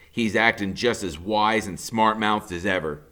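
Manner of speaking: ordinary delivery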